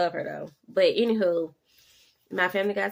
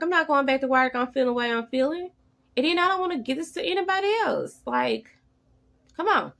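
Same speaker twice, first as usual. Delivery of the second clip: higher and louder, fast and fed up; refusing the job, higher and louder; indignant refusal; 8 semitones higher, 6 dB louder than usual. Retold or imitated. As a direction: tell the boss no way. Refusing her job's return demand.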